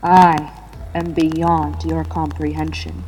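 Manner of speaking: loud, cold, iron-hard spirit; a dark god speaking coldly. Acted. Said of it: "as cold as stone and hard as iron".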